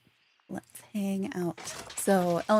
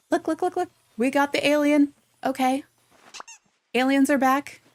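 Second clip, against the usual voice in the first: higher and louder, thrilled discovery; excited; 7 semitones higher, 5 dB louder than usual. Real, not acted. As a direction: blurt it out, delighted and eager. Spots the returning alien, thrilled.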